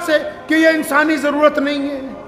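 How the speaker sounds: high-pitched rhetorical demand; challenging preaching. Real, not acted. Preacher asking who is responsible, challenging preaching. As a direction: demand an answer from the congregation.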